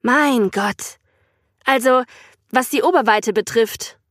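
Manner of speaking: a touch offended; slightly piqued. A narrator's reading. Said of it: "etwas pikiert".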